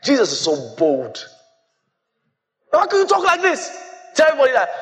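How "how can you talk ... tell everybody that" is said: mock disbelief. Voices amazement at Jesus' boldness.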